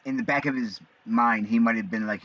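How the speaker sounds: ordinary talk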